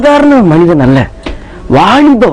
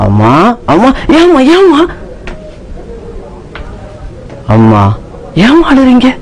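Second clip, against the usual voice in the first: put-on higher, anxious young son; worried son; 5 semitones higher than usual. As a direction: plead with a crying mother. Son worried seeing mother crying. (அழும் அம்மாவிடம் கவலையுடன் மகன்)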